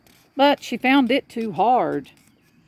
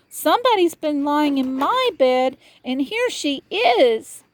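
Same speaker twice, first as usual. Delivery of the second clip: put-on much higher, tiny baby bear, slowing at the end; 9 semitones higher than usual. A narrator's reading. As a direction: squeak it out, then reveal her slowly.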